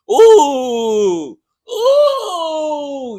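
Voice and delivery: high excited ooh, cried out; excited whoop. Real, not acted. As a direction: whoop with delight at the shoes. Excited about his orange shoes.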